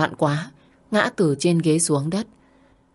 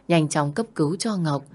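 ordinary delivery

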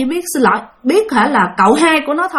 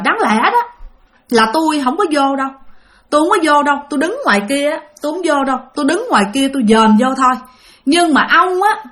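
higher-pitched voice of a dead wife, retold; another woman's voice; 3 semitones higher than usual. The dead wife, through the possessed aunt, speaks toward the coffin in a higher woman's voice. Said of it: "mà là một cái giọng đàn bà khác".

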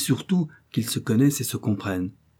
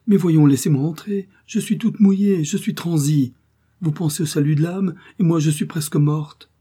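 higher and louder, urgent plea; a drenched woman urging the monk to open; pleading woman; 7 semitones higher, 5 dB louder than usual. A narrator's reading. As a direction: beg him to hurry, soaked and cold.

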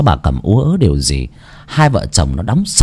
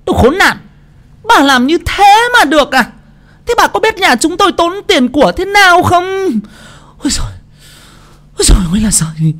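furious shrill wife. A narrator's reading. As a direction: rage at the old woman.

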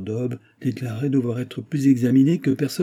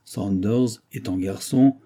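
ordinary delivery

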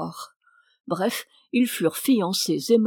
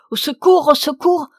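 much higher and louder cries for help; screaming for help; 7 semitones higher, 8 dB louder than usual. A narrator's reading. Wife dragged by her husband, screaming for help. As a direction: shout for help with all her strength. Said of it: "criait de toutes ses forces".

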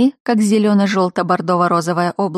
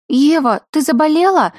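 high, louder scared little princess; frightened cartoon princess; 9 semitones higher, 5 dB louder than usual. A narrator's reading. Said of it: "перепуганный голос принцессы".

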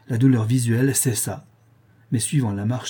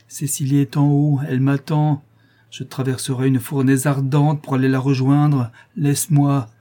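raised, louder, obsessed pleading; agitated insistence; 4 semitones higher, 5 dB louder than usual. Acted. Obsessed man demands his accomplice let him go, agitated insistence. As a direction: insist feverishly on getting through.

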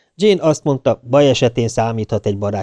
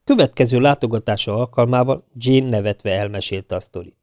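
usual speaking voice

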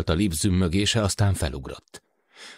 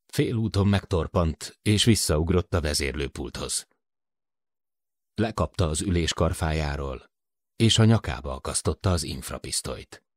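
ordinary delivery

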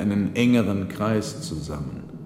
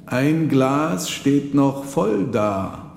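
slow, higher pointed remark; Marchese breaking the silence; 6 semitones higher, 4 dB louder, much slower than usual. Acted. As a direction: cut through the silence. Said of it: "unterbrach der Marchese die Stille".

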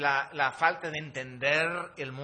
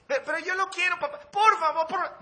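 put-on high, loud, laughing little-girl begging; squealing child pleading for a toy; whiny little girl; 10 dB louder, faster than usual. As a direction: beg like a greedy child in the store. Daughter voiced pleading.

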